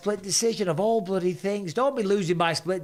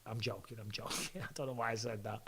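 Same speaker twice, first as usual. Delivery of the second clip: much quieter, lower aside; sheepish backtrack; 5 semitones lower, 12 dB quieter than usual. Real, not acted. Podcaster backing off a joke, sheepish and quiet. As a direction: drop your voice and take it back.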